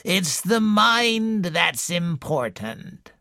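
put-on slow, wheezy old woman's voice; slow wheezing invalid complaining to a visitor; wheezing old lady. A narrator's reading. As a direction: wheeze it out like a sickly matriarch. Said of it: "wheezed Missus Boynton".

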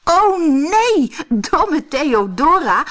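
loud, high, amused "oh no"; high-pitched merry teasing, loud; laughing exclamation. A narrator's reading. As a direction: exclaim it through acted laughter, drawn out. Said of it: "lachte Brammetje Braam".

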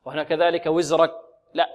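ordinary talk, nothing marked

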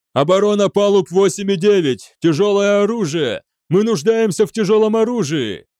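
high, urgent shout; yelled orders. A narrator's reading. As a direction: shout for help into the comms. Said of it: "вопил он, активируя связь". (отчаянно кричать по связи)

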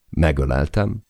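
ordinary delivery

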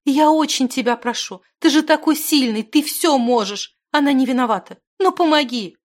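high, urgent begging; tearful pleading. A narrator's reading. Zimana begs the wizard to save Stekhana, tearfully pleading. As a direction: implore loudly, building phrase on phrase. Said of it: "подбежала к нему со слезами Зимана".

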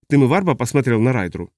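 usual speaking voice

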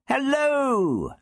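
high, drawn-out cheery hello; merry high-pitched greeting; cheerful call. A narrator's reading. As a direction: call out brightly to the room. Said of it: "the Doctor called cheerfully".

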